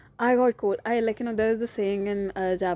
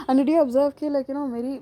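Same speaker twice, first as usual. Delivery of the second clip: ordinary talk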